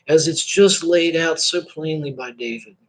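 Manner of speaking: ordinary talk, nothing marked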